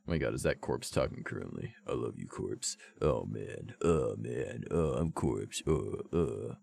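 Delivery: very low, soft mock-idol voice. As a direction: drop way down into a hushed, deep parody. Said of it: "I'm fucking Walmart brand [name]".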